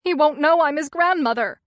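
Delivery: tearful, pleading. A narrator's reading. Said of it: "wiping tears from her eyes".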